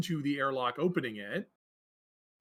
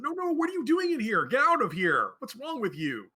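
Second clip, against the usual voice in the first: alarmed shout; 8 semitones higher, 4 dB louder than usual. Acted. GM voices the doctor scolding.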